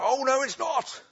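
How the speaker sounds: high, strained mock yell; hammed-up pantomime outburst; escalated panto shout. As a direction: top the last retort in a squeezed high voice. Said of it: "they're getting louder and louder".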